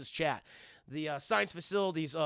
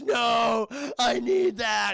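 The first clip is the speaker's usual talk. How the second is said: loud, raised mock despair; desperate wail; 4 semitones higher, 6 dB louder, much slower than usual. Hagen sees the juice go down the sink, desperate cry. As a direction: cry out for what is lost.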